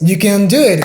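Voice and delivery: usual speaking voice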